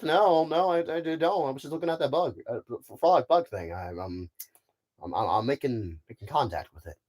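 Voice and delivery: lightly acted flustered excuse, trailing down; Buzz Lightyear. Space ranger explains the bug to a crewmate, mildly flustered. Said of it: "he's gonna be a great Buzz Lightyear".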